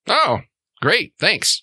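high sarcastic thanks; sarcastic troll. Acted. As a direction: toss off dry sarcastic thanks. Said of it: "he goes".

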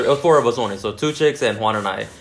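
ordinary talk

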